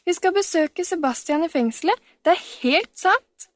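put-on a girl bursting with her news; excited little girl. A narrator's reading. Camilla boasts to Storpeder, excited girl's voice. Child boasting about prison visit.

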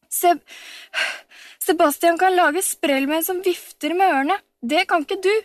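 flustered boast. A narrator's reading. Breathless Camilla boasts to Storpeder, high and loud. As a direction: trip over the first word, then boast.

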